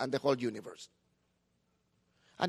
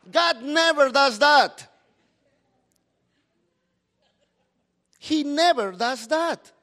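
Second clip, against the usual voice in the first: NEVER hit hard, slow and louder; emphatic insistence; 6 semitones higher, 7 dB louder, slower than usual. Acted. Preacher tells the church God never does that, slow and emphatic. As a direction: press the word never home slowly.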